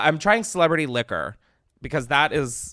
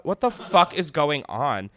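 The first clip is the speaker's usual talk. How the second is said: ordinary talk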